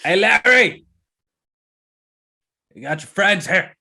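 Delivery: loud, high holler; big ogre shouting for the boss; calling out. Acted. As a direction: yell it through a closed door. Ogre calls Larry through the door.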